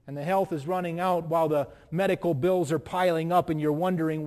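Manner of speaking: ordinary delivery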